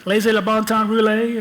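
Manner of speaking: mock New Orleans accent on the French saying; New Orleans French phrase. Showing the audience his New Orleans accent, playful mock French. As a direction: toss off the Louisiana French line in a playful put-on accent. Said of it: "I lived in New Orleans. Didn't that help?".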